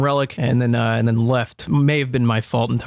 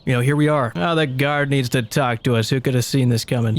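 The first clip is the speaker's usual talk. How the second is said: ordinary talk, nothing marked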